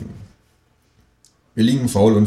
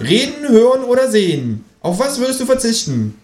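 indignant kangaroo. Acted. Kangaroo outraged at eating table decoration, high voice. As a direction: huff at the stupid decoration.

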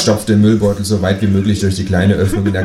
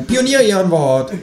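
high, promising kangaroo; high-pitched earnest talking animal; 12 semitones higher, 4 dB louder than usual. A narrator's reading. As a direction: swear it in a high creature voice. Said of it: "sagt das Känguru".

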